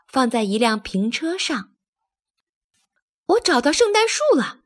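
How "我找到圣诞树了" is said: high, triumphant exclamation; excited shout. A narrator's reading. Thomas finds the Christmas tree, shouting excitedly. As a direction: cry out the discovery, pitched way up. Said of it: "托马斯大叫着".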